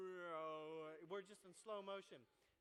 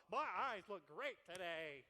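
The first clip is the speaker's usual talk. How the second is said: louder, slow preening boast; smug self-admiration; 3 semitones lower, 9 dB louder, slower than usual. Retold or imitated. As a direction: strut and admire yourself aloud. Strutting down the hall.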